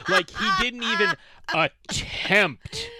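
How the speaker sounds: loud, high and slow with incredulity; exasperated disbelief. Real, not acted. Host complains to co-host about Connery's accent, loud exasperation.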